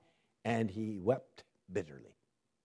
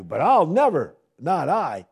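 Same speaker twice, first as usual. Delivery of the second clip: loud, higher insistence; proud boast; 5 semitones higher, 10 dB louder than usual. Acted. Preacher paraphrasing Peter's loud, proud insistence. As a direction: push hard on never.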